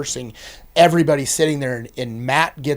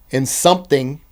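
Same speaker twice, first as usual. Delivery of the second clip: ordinary talk, nothing marked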